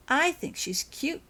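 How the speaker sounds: put-on high, slow little-girl gush; a small girl gushing over a kitten. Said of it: "gushed Lily".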